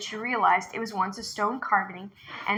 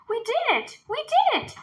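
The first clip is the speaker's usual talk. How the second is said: put-on high, delighted children's cheer; cheering kids; 14 semitones higher than usual. A narrator's reading. Twins celebrating the tiger's defeat, high and joyful. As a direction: shout it out in triumph. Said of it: "laughed as they ran".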